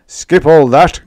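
loud impatient interruption; hasty cry. Acted. Bellman hurrying the baker along, loud and impatient. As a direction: cut him off quickly. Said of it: "cried the bellman in haste".

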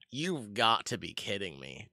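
ordinary delivery

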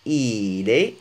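"إيلي" starting on a long ee, like il est; downward ee vowel. Shown as a demonstration. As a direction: stretch the first vowel of "إيلي" as a close ee. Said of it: "هنا إلى".

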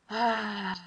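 long audible sigh out; exhaling sigh. Shown as a demonstration. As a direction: breathe out with a sigh. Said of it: "Exhale with a sigh".

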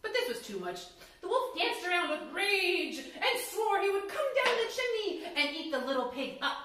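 usual speaking voice

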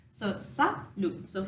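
ordinary talk, nothing marked

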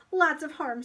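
put-on loud, high boyish refusal; emphatic child's protest; firm refusal. A narrator's reading. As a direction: shut the temptation down hard. Paul argues back.

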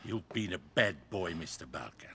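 low soft menace, sinking to near silence; menacing. Acted. Thug quietly threatening the tied-up captive.

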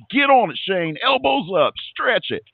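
high-pitched mock yell at a racer; excited fan's holler, imitated without full volume; retold yelling. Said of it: "I was yelling at my computer".

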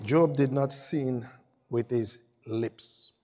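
solemn scripture reading. Acted. Preacher reading Job's verdict slowly and gravely. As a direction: let each word land slowly. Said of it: "repeated slow read".

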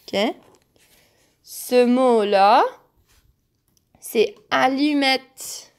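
ordinary talk, nothing marked

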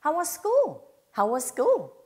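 "How was school" with one s, was flowing into school; teacher models single s for repeating; single s linking. Shown as a demonstration. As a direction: glide from was straight into school. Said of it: "school로 넘어가시면".

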